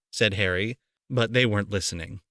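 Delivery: ordinary delivery, nothing marked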